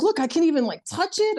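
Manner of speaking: put-on the kid eagerly showing that she can't feel her toe anymore; imitating her young daughter. Daughter voiced in a retold story.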